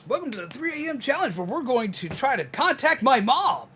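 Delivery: high, loud hyped streamer intro; clickbait video narrator. Pretend. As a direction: pitch it like a clickbait challenge video. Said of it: "use the streamer voice".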